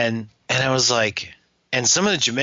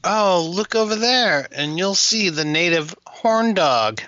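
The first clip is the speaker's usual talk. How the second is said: mock tour guide; 7 semitones higher than usual. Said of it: "Hey, [name]".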